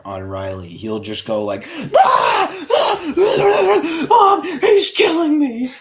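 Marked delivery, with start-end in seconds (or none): 2.0-5.7 much higher, louder shrieking cry for mom; imitating whiny little brother. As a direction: shriek like a kid tattling to his mother.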